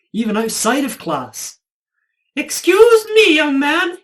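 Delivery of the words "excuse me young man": much higher, louder mock teacher correcting a boy; imitating a prim teacher. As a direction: ham up a fussy teacher's correction. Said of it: "My teachers corrected me".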